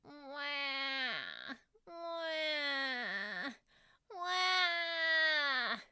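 high mock wailing cries, hammed-up; imitated wailing. Mom imitating her wailing son: high mock crying. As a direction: wail like a sulking boy under a blanket, mock cry. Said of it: "the lump on the couch began to wail".

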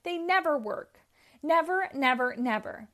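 high, loud whining tantrum. Acted. Robotnik throwing a tantrum over his failed robots, loud whining. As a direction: stamp out each never like a sulking child. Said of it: "threw a tantrum".

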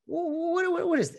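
high, baffled stammer; can't believe what you're seeing; stuttering disbelief; incredulous. Retold or imitated. Incredulous inner reaction.

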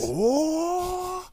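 high Goku power up cry; power up scream. Retold or imitated. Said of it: "you sound like Goku powering up".

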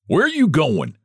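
shouting. A narrator's reading. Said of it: "he shouted".

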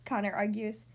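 usual speaking voice

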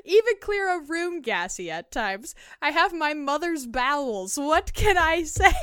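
high and loud with laughter; excited, laughing. Acted. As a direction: read it barely holding back laughter. Reading confession, laughing.

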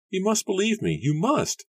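high-pitched, urgent begging; fierce plea. A narrator's reading. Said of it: "Susanna whispered fiercely".

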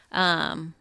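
ordinary delivery

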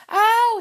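high, loud yelp; sharp cry of pain. A narrator's reading. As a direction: yelp as if stung by cold. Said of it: "he cried".

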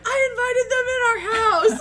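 high, loud shout breaking into laughter; retold yelling back. Wife yelling back at husband about the paramedics, laughing shout. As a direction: yell it back, exasperated and comic. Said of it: "And so then I yell at him".

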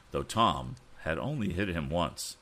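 plain reading voice

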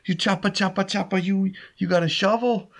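high, hammed-up Italian accent. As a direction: play the excited Italian groundskeeper in a thick Italian accent. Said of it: "and he says".

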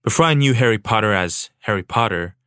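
normal talking voice

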